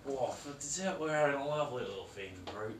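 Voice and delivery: put-on broad Australian accent, half-joking; a mock Aussie cooking guest; hammed-up Aussie accent.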